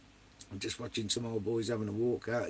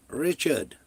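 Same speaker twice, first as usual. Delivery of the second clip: usual speaking voice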